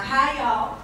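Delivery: lightly put-on Southern drawl greeting; Southern accent. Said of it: "the only accent that I can do".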